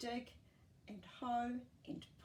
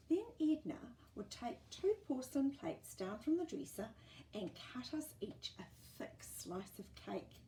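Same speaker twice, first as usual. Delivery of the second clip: plain reading voice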